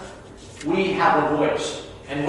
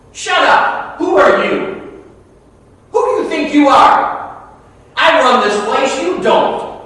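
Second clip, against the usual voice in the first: loud, high, angry berating; acted shouting-down from someone in charge; belittling put-down; 6 semitones higher, 7 dB louder than usual. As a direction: tear someone down with your voice. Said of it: "We put people down with our voice".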